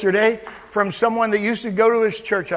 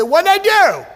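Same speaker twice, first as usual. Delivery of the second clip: louder, higher, defiant question; bold challenge; 7 semitones higher, 4 dB louder than usual. Shown as a demonstration. Preacher showing the congregation how to answer the enemy, loud and defiant. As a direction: throw it back at the accuser. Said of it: "Just turn around and say".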